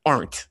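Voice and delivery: "aren't" said with a clear R sound; R in aunt. Retold or imitated. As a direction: pronounce "aren't" with the R as the word for aunt. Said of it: "I thought it was".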